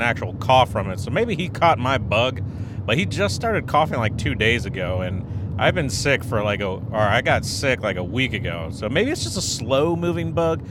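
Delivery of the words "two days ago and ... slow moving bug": ordinary delivery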